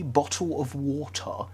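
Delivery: slow, careful posh "Bottle of water" with a dropped T; posh British. Shown as a demonstration. A Brit shows his posh "Bottle of water", slow and careful. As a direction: say "Bottle of water" like you have millions in the bank. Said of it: "I already sound like I have millions in the bank".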